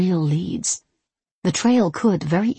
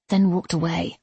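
ordinary delivery, nothing marked

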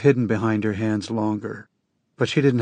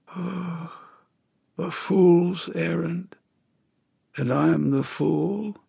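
high, slow hoarse voice of a sick woman; a dying woman's rasping plea; 4 semitones higher, slower than usual. A narrator's reading. Narrator voices dying Astrid.

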